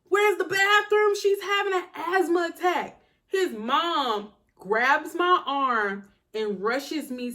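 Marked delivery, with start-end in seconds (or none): 0.1-3.0 urgent. Retold or imitated. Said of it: "Jamie's like".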